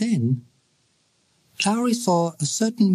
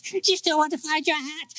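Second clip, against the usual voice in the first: much higher, thin ghostly "hat?"; high eerie voice; 13 semitones higher, 3 dB quieter than usual. A narrator's reading. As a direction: float the question up like a phantom child. Echo repeated, high voice.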